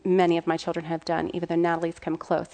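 ordinary talk

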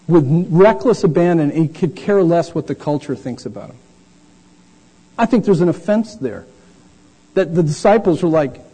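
ordinary delivery

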